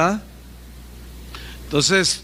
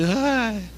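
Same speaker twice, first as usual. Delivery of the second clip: mock whine; 3 semitones higher than usual. Preacher mimics his own complaint.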